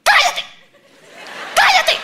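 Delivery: loud, high mock snap to shut up; snapping at her. Husband silencing his panicking wife, loud mock snap. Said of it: "así reacciona él cuando está angustiado".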